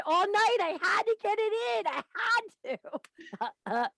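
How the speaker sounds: high, laughing; giggly confession. Real, not acted. Host joking about her night to her co-host, giggling. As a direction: laugh your way through it.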